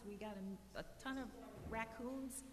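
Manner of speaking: usual speaking voice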